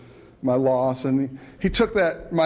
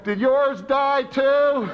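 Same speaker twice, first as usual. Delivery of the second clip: much higher, very slow mock call; higher drawn-out reply hollered across the room; mock yell back; 10 semitones higher, much slower than usual. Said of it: "his sponsor yells back".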